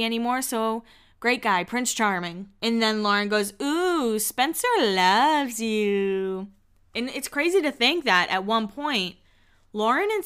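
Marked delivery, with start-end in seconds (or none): 3.6-6.5 teasing singsong. Retold or imitated. Lauren to Heidi about her new boyfriend, teasing singsong. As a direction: stretch every word in a mock girlish tease.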